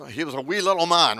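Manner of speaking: loud, quick mock-Scottish; Scottish accent. Preacher answering his own question from the song, Scottish accent. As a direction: toss it off in a Scottish lilt. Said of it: "Scottish accent when I say little man".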